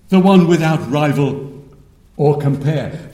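emphatic preaching. Real, not acted. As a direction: build to a high, exultant peak. Preaching with rising conviction.

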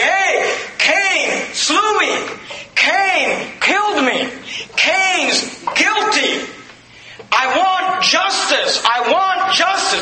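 Abel's blood crying out. Acted. Preacher voicing Abel's blood, high slow crying out.

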